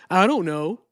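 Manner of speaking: retold guest answering he doesn't know; imitating the guest. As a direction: play him as blank and caught out. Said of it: "So I said".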